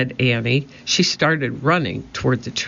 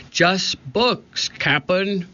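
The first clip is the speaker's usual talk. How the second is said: higher-pitched crewman's call; shouting; 5 semitones higher, much slower than usual. A narrator's reading. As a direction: report back to the captain in a raised pitch. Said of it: "shouted Stinky".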